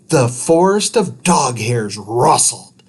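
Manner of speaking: ordinary delivery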